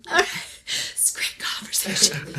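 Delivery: breathy whisper to herself. Retold or imitated. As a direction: mutter it under your breath. Awkward aside after leaving him.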